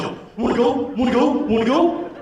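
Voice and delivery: chest-bump taunt, high; bro voice. Retold or imitated. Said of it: "They're like".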